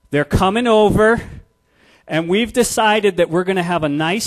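put-on a child thrilled with her own plan; excited daughter. Daughter telling her dad about the study, gushing high girl voice.

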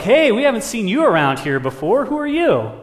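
loud, high-pitched challenge to a newcomer; high, loud crow character; brash crow. Acted. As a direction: call out boldly to a stranger. Crows greet the strange parrot.

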